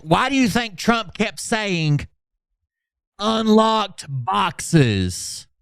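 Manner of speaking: high, drawn-out mocking sing-song; mocking Phil Godlewski. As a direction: stretch the words to ridicule him. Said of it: "Phil Godlewski says".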